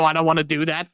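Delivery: normal talking voice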